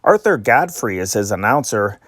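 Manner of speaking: ordinary talk, nothing marked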